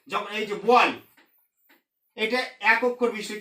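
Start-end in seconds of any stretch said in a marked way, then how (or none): none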